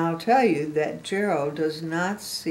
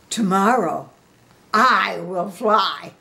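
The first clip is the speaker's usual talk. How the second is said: determined Gerald; 3 semitones higher, 9 dB louder, slower than usual. A narrator's reading. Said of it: "And Gerald says".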